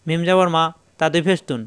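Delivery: normal talking voice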